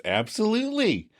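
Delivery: high, keen imitated agreement; Chandler agreeing emphatically. Boyfriend agrees to naming the award, emphatic. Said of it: "And Chandler agrees".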